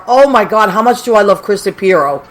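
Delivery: ordinary delivery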